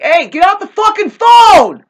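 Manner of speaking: full-volume retold scream at a junkie on the phone; furious shout. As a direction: yell him off the phone with everything you've got. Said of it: "I told him that".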